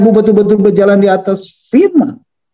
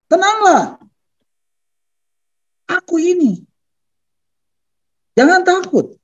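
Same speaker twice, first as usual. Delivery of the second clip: high, separated calls with long pauses; calling out reassurance; 13 semitones higher than usual. Acted. Jesus calls to frightened disciples, calling out reassurance. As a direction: call each phrase high across water, falling at the end.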